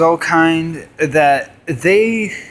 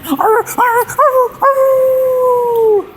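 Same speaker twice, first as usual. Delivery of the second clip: very high howling arr sounds; high wolf howl; much slower than usual. Acted. Wolves howling in joke.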